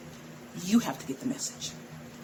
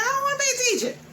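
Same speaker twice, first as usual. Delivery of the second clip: high, louder whine of her reluctant self; mock whiny protest; 6 dB louder, faster than usual. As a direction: whine it up high like a sulky kid. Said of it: "And I'm like".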